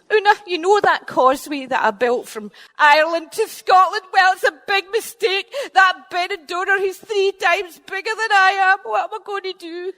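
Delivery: high worried pleading; plead, high and anxious; fretful giant. Acted. Finn begging his wife for help, high anxious.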